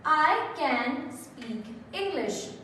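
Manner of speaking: "I can speak English" read slowly and evenly, word by word; slow board-reading voice; slow example. Shown as a demonstration. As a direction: spell out the model sentence slowly for the class. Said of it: "Let's take the example".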